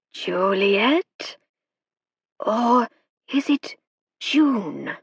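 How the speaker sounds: spirit guide speaking through the medium; slow foreign spirit growl. A narrator's reading. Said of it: "growly voice".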